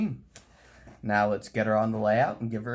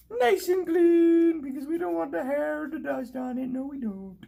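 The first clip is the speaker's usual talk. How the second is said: squeaky silly voice; 6 dB louder than usual. Pretend. Cleaning the tender, in a high squeaky cartoon voice. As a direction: play a tiny squeaky character fussing over cleanliness. Model-train vlogger goofs in squeaky voice.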